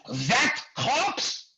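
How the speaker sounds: put-on high-pitched French-accented outrage. French director staring at the extra, French-accented disbelief. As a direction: imitate an indignant French director. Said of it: "It's French, this asshole".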